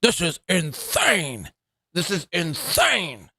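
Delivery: slow, stretched-out mock replay; slowed down playback. Shown as a demonstration. Host replaying the streamer's line, slowed right down. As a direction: drag each word out slowly. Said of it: "Let's slow this down".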